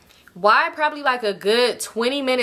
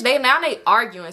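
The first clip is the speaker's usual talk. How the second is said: usual speaking voice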